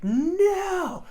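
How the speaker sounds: high, loud, drawn-out no; mock dismayed cry. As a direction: re-enact wailing no at a friend's mistake. Retells dismayed reaction to the jump.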